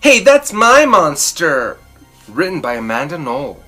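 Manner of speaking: ordinary delivery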